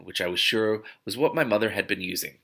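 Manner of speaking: usual speaking voice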